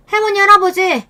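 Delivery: high, loud desperate call; shouting daughter. A narrator's reading. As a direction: cry out to the grandparents.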